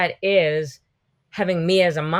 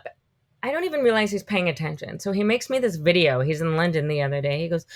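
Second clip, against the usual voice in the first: usual speaking voice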